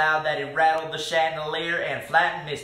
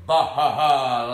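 low, slow bah-ha-ha; mocking villain laugh; 6 semitones lower, 3 dB louder than usual. A narrator's reading. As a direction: laugh like a scornful twister. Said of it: "laughed the twister".

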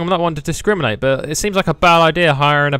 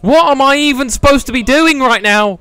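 exasperated; 11 semitones higher, 6 dB louder than usual. Real, not acted. Lost in the game, exasperated.

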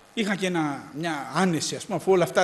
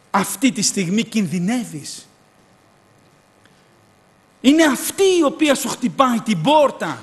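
higher, louder preaching at full force; a preacher on fire, higher and louder; fiery preaching; 5 semitones higher, 5 dB louder than usual. Acted. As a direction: preach it to the whole church.

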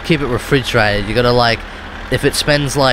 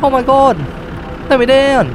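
panicked passenger; 13 semitones higher than usual. Retold or imitated. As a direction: squeal it like a panicking captive. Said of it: "He's like".

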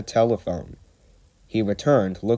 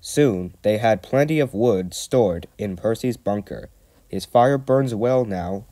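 usual speaking voice

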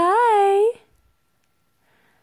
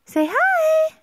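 sing-song coaxing; 12 semitones higher than usual. Real, not acted. Mother urging baby to say hi, high sing-song.

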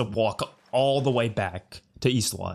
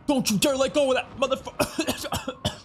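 high, frantic yell at the screen; shouting at screen; 12 semitones higher than usual. Real, not acted. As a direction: yell it high until the breath gives out. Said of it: "Sorry, I'm choking".

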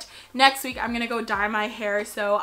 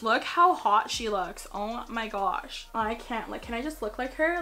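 ordinary talk, nothing marked

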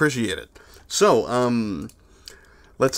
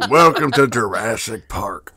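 Arthur Morgan voice; 3 semitones lower, slower than usual. Pretend. Repeating the line for chat as Arthur Morgan, slow drawl. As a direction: lean into the outlaw voice, slowly. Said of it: "in the Arthur Morgan voice".

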